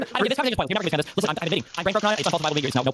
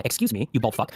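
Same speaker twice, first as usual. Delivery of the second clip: sharp mock; 9 semitones lower, slower than usual. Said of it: "very sharp and very keen to clarify".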